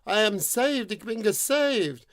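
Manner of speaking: relieved maiden. Acted. The rescued maiden thanks God she is saved, relieved. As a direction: gasp out your thanks. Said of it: "and went".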